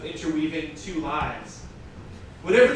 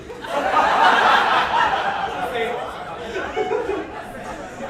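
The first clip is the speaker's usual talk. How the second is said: a burst of helpless high laughter; laughing fit mid-reading; long high laugh; 12 semitones higher, 4 dB louder than usual. Real, not acted. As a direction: crack up, breathless and high. Reader breaks into laughter.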